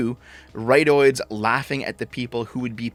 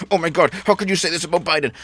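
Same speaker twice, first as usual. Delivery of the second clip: higher, quick, clutching-pearls whine; mock outrage; 5 semitones higher, 4 dB louder, faster than usual. Vlogger mimics hack journalists, mock horrified whine. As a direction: parody a shocked hack journalist.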